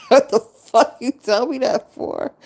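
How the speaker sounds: high, loud, laughing outrage; laughing disbelief. Retold or imitated. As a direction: laugh through the question in disbelief. Laughing retelling her own outrage.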